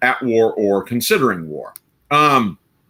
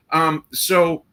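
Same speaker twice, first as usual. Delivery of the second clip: ordinary talk, nothing marked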